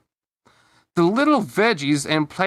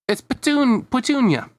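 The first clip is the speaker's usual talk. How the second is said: put-on high-pitched little girl voice; sweet small character, pitched high; Petunia; 9 semitones higher than usual. A narrator's reading. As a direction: answer up high like a cartoon girl.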